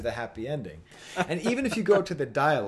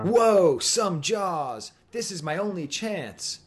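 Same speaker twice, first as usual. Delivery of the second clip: higher, loud-starting superhero wisecrack; Spider-Man style quip; 5 semitones higher, 4 dB louder, much slower than usual. Retold or imitated. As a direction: quip it like a startled cartoon hero. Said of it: "very much in a Spider-Man style dialogue".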